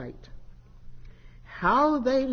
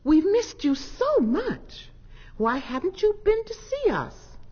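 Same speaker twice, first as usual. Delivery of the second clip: joyful welcome; 11 semitones higher than usual. A narrator's reading. Family greeting Snoopy Cat, high and joyful. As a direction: gush with happy relief.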